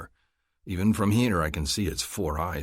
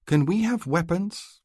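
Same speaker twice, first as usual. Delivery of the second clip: put-on young woman's voice; 12 semitones higher, 4 dB louder than usual. A narrator's reading. Female character asks.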